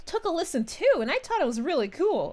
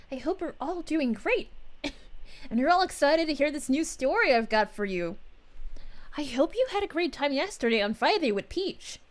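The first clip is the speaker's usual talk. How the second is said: ordinary talk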